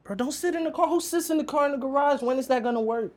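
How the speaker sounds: high-pitched exasperated plea; pleading in disbelief, voice way up. Real, not acted. Exasperated warning, pitch jumps.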